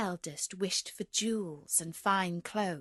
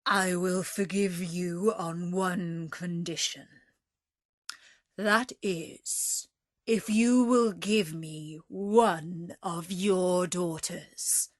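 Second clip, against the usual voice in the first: less terrible voice; 2 semitones lower, 3 dB louder, slower than usual. Acted. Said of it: "said in a less terrible voice".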